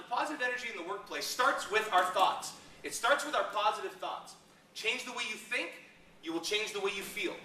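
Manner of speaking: ordinary talk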